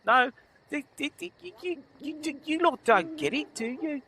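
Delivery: high, scoffing town guard; a guard scornful of a cheap bribe; incredulous guard. Acted.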